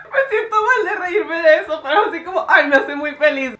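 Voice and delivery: guilty laughter through the words; giggling in spite of herself; laughing. Real, not acted. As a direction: laugh while apologising for laughing. Laughing at the sad rabbit video.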